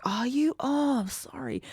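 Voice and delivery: high, soft apologetic character quote; Joey. Acted. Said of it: "Joey being like".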